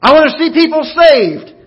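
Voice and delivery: loud, high, ringing declaration; exclaimed. Shown as a demonstration. Preacher demonstrates exclaiming a desire for souls, loud and fervent. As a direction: shout it out like an exclamation point. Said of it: "Exclamation point".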